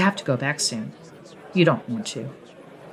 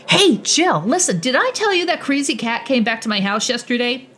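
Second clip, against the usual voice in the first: chatty coworker; 8 semitones higher than usual. Acted. Chatty coworker voiced higher.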